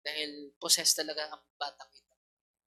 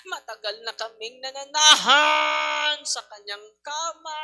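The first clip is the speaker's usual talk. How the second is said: high, slow pained demon cry building to a shout; groan, then yell as tortured demons; wailing demons; 6 semitones higher, 8 dB louder, slower than usual. Retold or imitated. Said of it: "Sabi ng mga demonyo".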